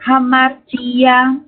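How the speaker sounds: "hamartia" said slowly, every syllable clear; careful model word. Shown as a demonstration. Teacher modelling the Greek word "hamartia" for students, slow and careful. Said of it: "por exemplo".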